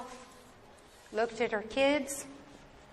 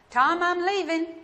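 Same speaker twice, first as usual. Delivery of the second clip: calling out; 6 semitones higher, 4 dB louder than usual. Acted. Grandma calls back leaving.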